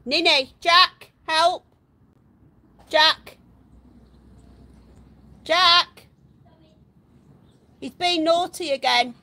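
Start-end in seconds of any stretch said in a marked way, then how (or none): 0.1-6.1 loud, urgent call across the garden; loud call to Jack for help with a runaway pet; shouting for help. Real, not acted. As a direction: yell for Jack to come help now.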